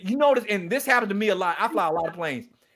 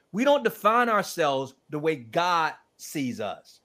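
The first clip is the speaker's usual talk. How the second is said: ordinary talk, nothing marked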